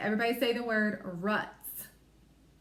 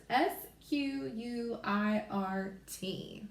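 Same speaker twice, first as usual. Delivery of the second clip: slow letter-by-letter spelling; spelling slowly; much slower than usual. Shown as a demonstration. Teacher spelling for young learners, slow letters. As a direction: spell it out one letter at a time. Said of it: "So we spell squirt".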